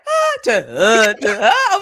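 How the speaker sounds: mock loud "ah" and "uh" noises; imitating singing jumps. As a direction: mimic jumps between pitches on bare vowels. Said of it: "you went from, like".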